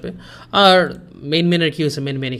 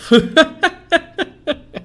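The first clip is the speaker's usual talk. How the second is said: laughing; 14 semitones higher, 7 dB louder than usual. Real, not acted. Host laughs at troll chat.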